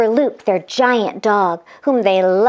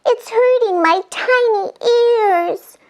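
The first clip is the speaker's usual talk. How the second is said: very high fairy voice; fairy with hurting ears; tiny fairy; 10 semitones higher than usual. Acted. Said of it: "said Fairy Cora".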